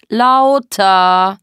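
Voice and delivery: long, loud drawn-out yell; shouted order. Acted. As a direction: stretch the command into a yell. Er brüllt den Befehl. (laut gebrüllter Befehl)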